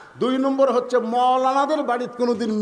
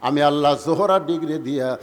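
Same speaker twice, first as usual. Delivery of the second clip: melodic divine proclamation; 5 semitones lower, 3 dB louder than usual. Acted. God answers Ali about Fatema's grave, low melodic chant. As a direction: intone it solemnly, swelling toward the end.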